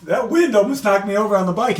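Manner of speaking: high-pitched, amused retelling; laughing recollection. Retold or imitated. Athletes swapping stories after the race, laughing recollection. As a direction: relive the scare as a funny story. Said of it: "and you're laughing".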